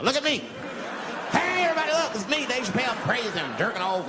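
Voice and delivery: high, loud yelling at everybody; hollering out the window for attention; shouting to the street. Pretend. Comic playing himself calling out from his window, loud shout.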